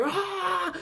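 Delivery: a high, full-throated rock-singer ah; wild rock-singer cry; intense rock yell. Shown as a demonstration. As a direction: belt the ah with full force like the band singing in Spanish. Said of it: "en español es como que".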